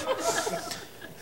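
a soundless high giggle; high unvoiced laughter; breathy high giggle. Real, not acted. Laughs at own story.

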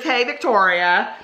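drawn-out mock-friendly mean-girl greeting; slow, taunting hello. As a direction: stretch it slowly like a schoolyard mean girl. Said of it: "this is one of the mean girls".

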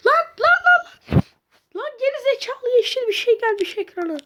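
high, loud startled shriek; frightened high yelp at the screen; panicked shriek. Real, not acted. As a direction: squeal in sudden alarm. Sudden screen glitch panic.

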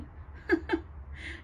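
high-pitched witchy laugh; witch's cackle. A narrator's reading. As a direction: cackle with wicked amusement. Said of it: "She laughed".

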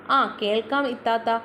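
plain reading voice

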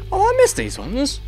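high delighted surprise; surprised. Real, not acted. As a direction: lift up high, pleasantly caught off guard. Discovering missed items.

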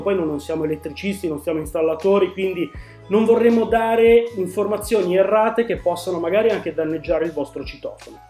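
usual speaking voice

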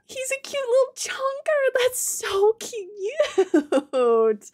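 high, drawn-out squealing; cute squeal. Real, not acted. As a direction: gush like seeing a puppy. Gushing over cute little creature.

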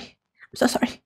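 barely audible whispered sorry; trailing whispered sorry; fainter whisper. Real, not acted. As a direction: let the apology fade into a whisper. Apologizing for being behind chat.

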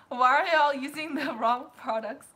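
loud laughing protest; laughing complaint. Real, not acted. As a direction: laugh while scolding playfully. Laughing at wrong products.

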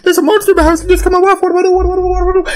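squeaky high, frantic neighbor voice; hammed-up terror; panicked Jacob. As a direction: panic in a tiny high put-on voice.

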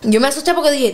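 usual speaking voice

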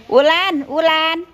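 calling out. Real, not acted. Telling Wulan from across the house to turn the TV on, called out loud. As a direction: call Wulan loudly and high.

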